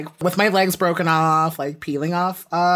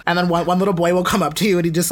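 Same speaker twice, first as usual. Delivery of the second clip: ordinary talk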